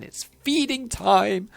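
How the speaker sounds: high, loud, drawn-out character voice; hammed-up gleeful character. Elf cutting into talk of insect spirits, hammed-up glee. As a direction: sing it out with theatrical relish.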